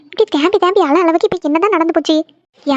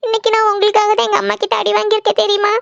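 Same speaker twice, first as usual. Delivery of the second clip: normal talking voice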